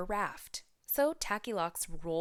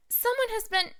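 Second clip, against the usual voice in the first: put-on tiny Baby Bear; 5 dB louder than usual. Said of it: "Baby Bear said".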